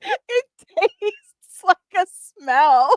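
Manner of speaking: slow and high, savouring it; slow high mock repeat. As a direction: draw the line out in a high voice. Repeating the quote slowly.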